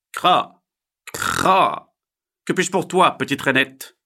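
croak, then greet loudly as a heron. Acted. Heron answers little frog, loud bird voice.

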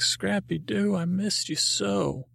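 high, slow, doting reunion voice; tender nutcracker dad. Acted. Nutcracker father greeting his lost puppy, high and tender. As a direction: stretch it out like a weepy father.

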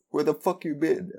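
high, sudden mock blurt of the Chinese guy's line; light imitation of a character's first English line; quoting the Chinese guy. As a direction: pop it out high, imitating a surprise outburst. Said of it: "He's like".

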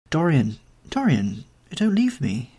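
ordinary delivery

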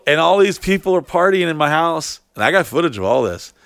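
ordinary talk

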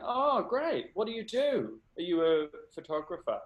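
high, chirpy small talk; bright-eyed interest, pitched well up; polite enthusiasm. Retold or imitated. Bartender making small talk with a guest, high chirpy enthusiasm.

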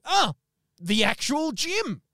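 high, slow, awed "the actual Jim"; starstruck. Retold or imitated. Starstruck caller gushing to Jim on the phone, high and slow. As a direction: gush at meeting the famous man. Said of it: "And I'm like".

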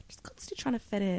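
light put-on American, under the breath; American accent. Shown as a demonstration. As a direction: slip into a hushed American accent. Demonstrates pretending to be American.

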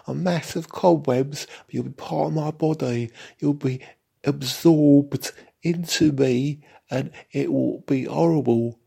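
high, loud, camp London voice of a hungry cobweb; camp London cobweb monster. Acted. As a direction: gloat like a camp London spider about to eat you. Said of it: "in a rather camp London accent".

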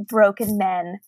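ordinary delivery

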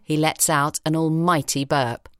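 plain reading voice

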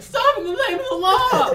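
high, loud yell cracking; whiny shout with a voice crack. Acted. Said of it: "there's a voice crack".

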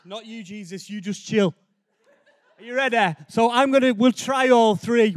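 ordinary delivery

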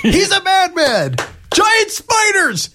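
very high, drawn-out mock scream; mock yelling. Mimicking a fleeing man who yells plot points, high drawn-out yell. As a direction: yell it slowly in a strained high voice. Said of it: "he is yelling plot points".

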